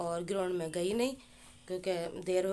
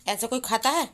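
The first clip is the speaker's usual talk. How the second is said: loud, high, playful telling-off; teasing scold; 4 semitones higher, 7 dB louder than usual. Real, not acted. Mother loudly shaming her chocolate-smeared son to viewers. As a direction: show everyone the mess he made, half laughing at him.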